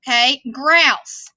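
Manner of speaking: ordinary talk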